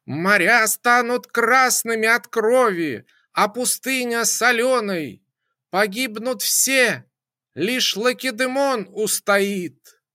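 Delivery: high, loud screaming prophecy; shouting. A narrator's reading. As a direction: scream the doom from the depths. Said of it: "вновь перешла на крик".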